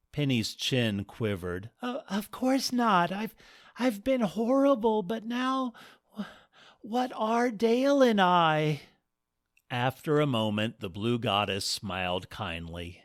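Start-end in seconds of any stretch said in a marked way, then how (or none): 1.8-8.8 put-on higher, stammering young woman; a touch of tearful stammer; tearful bride.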